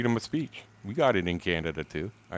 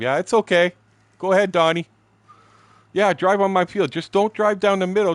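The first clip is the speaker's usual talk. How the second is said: mock Shaw; 10 semitones higher, 6 dB louder than usual. Shaw mocked standing up at meeting.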